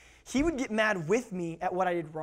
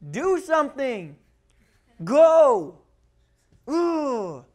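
high, loud mock kid's dare; goading kid; 7 semitones higher, 10 dB louder, much slower than usual. Kid dares the counting parent to act, high goading voice. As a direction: egg the parent on in a raised voice. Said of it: "all along the kid's like".

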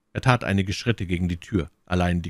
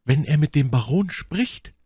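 worried soliloquy; 9 semitones higher, 5 dB louder than usual. A narrator's reading. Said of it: "sagte sie sich".

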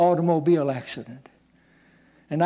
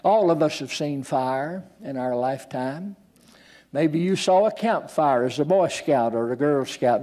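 usual speaking voice

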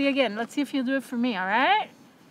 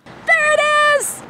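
high, loud playful cry; excited shout; 11 dB louder than usual. Real, not acted. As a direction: hype the dog up about a squirrel. Teasing dog about squirrel.

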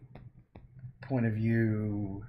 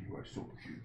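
whisper; 10 dB quieter, faster than usual. Real, not acted. Cooing to pet Nugget.

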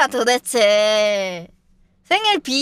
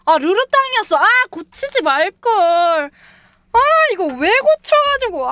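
frustrated whine; 7 semitones higher, 4 dB louder than usual. Real, not acted. Regrets changing the answer.

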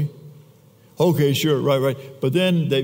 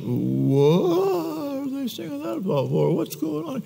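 whiny jealous king; 9 semitones higher, slower than usual. Retold or imitated. Jealous Saul hears the song.

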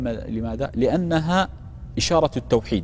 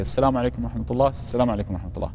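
rushed, fast salam without pause; hurried greeting; 3 semitones lower, much faster than usual. Shown as a demonstration. Teacher showing students the common rushed salam, fast. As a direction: rattle off both greetings quickly. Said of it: "جل الناس يسلم هكذا".

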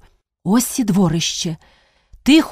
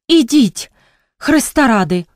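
desperate begging; 4 semitones higher than usual. Acted. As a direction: plead for God's sake. (відчайдушне благання)

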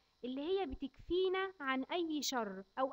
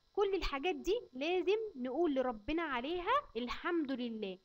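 normal talking voice

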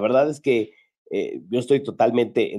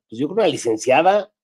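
normal talking voice

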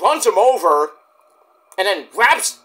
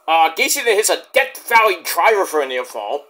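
ordinary delivery

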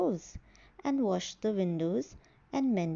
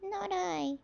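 squeaky mouse; 8 semitones higher, 4 dB quieter than usual. Acted. Mouse refuses in story.

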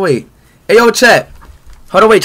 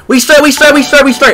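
loud hype; 10 semitones higher, 10 dB louder than usual. Real, not acted. Streamer hyping himself after a break.

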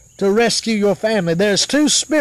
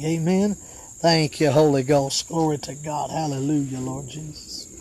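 low, soft prayer trailing away; hushed, rolling devotional refrain; murmured praise; 5 semitones lower, 6 dB quieter than usual. Real, not acted. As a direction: murmur thanks to God under the preaching. Murmured praise between preaching.

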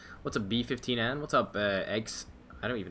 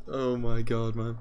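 ordinary delivery

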